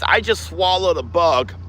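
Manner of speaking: ordinary delivery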